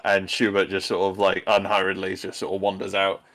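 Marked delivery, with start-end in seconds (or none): none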